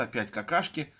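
usual speaking voice

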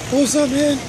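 high, bright mock bro greeting; casual greeting to a friend. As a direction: toss out a friendly what's up. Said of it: "I was like".

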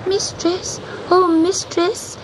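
high-pitched kitten calling out; crying out. A narrator's reading. Kitten calling to the sea witch above the storm, high cry. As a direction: call out high and pleading, like a small kitten. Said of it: "he cried above the storm".

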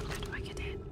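voiceless whispered question to herself. Real, not acted. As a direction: breathe the question out with no voice. Whispering while trying to enter.

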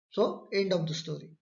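usual speaking voice

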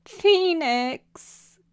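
high, tearful wail; hiccuping sob. Acted. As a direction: call out through sobs. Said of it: "Quixotic hiccuped".

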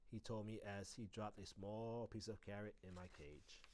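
ordinary delivery

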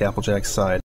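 plain reading voice

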